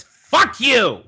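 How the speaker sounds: high shrieking mock yell; mock drunk shout. Sister sitting bolt upright yelling at him, shrill mock shout. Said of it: "and she says".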